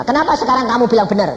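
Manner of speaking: quick, high challenge; questioning son. Retold or imitated. Son challenging his father, high and quick. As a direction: press him with a quick question.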